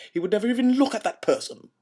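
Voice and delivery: high, loud, fast indignant burst; hammed-up scandalised protest; mock outrage. Pompous actor defending Olivier's spotlight, in mock outrage.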